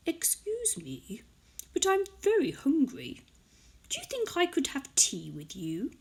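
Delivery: a hushed, high pleading tiger at the door; polite hungry tiger. Acted. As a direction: ask sweetly like a starving but well-mannered tiger. Tiger voiced reading picture book.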